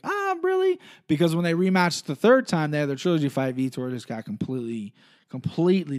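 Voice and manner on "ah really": jumps way up in pitch, sarcastic disbelief; a high incredulous "really?"; mock surprise. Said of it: "it's like".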